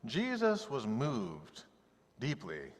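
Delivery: stretched out, sinking to a hush on deeply; preacher quoting scripture slowly; slow, weighty quote. Shown as a demonstration. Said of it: "it said twice".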